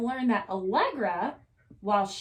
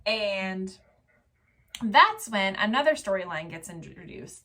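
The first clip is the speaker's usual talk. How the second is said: normal talking voice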